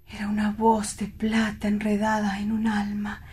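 hushed and far away in the past; dreamy whisper. Acted. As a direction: whisper it like a treasured memory. Lovesick man remembers the singer.

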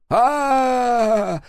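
wounded thug squealing in pain; high squeal. Acted. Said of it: "завизжал тот".

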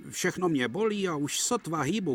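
tired duck engine. A narrator's reading. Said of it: "souhlasil kačer".

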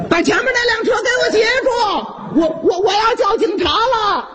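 high, fast shout; frantic yell. Acted. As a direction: bellow for help, rushing. Uncle yelling to stop the thief.

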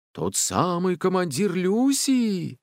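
slow, high, drawn-out question; echoing forest voice. A narrator's reading. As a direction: let the words carry through the grove. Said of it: "Её слова эхом разносились". (протяжный раскатистый голос)

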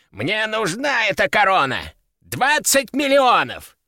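put-on high, drawn-out old man's outcry; indignant bidding. A narrator's reading. Old collector demands the crown, high indignant bid. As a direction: demand the prize, worked up.

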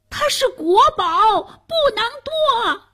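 very high, insistent cry; urgent protest. A narrator's reading. Hero shields the national treasure, urgent high protest.

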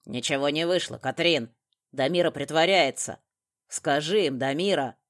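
loud, forceful address; barked accusation. A narrator's reading. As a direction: call it out loudly to the room. Makarov shouts orders and accusations.

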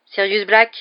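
ordinary delivery, nothing marked

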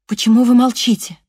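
high, loud, anxious question; frightened plea. Acted. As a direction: beg him to answer, alarmed. Frightened woman pleads with wounded man. (испуганно, громко и высоко)

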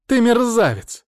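high, loud outburst; angry insult. A narrator's reading. Mother-in-law cuts into his TV speech, furious heckle.

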